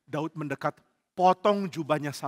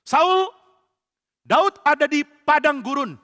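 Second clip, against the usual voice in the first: high, loud shouted report; acted urgent report; 11 semitones higher, 13 dB louder, slower than usual. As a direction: call it out to the king. Spy calls out to King Saul.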